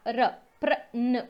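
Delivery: र drawn out, then prawn said slowly and clearly; teacher breaking prawn into र and prawn; syllable by syllable, slowed. Shown as a demonstration. Teacher spelling out the sound for students, slowly. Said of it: "प आधा पा".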